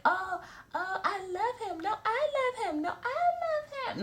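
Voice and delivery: very high, rising, gushing love-struck voice; hammed-up swooning over a man; mock lovesick woman. Mocking a confused side chick gushing over him, very high and swooning.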